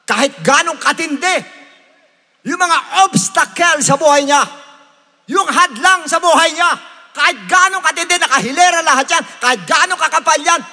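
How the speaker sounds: high, loud, fervent exhortation; full-force preaching. Real, not acted. Preacher urges believers past every obstacle, impassioned. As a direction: drive each phrase out hard.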